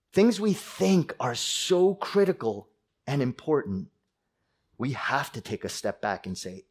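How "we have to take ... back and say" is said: ordinary delivery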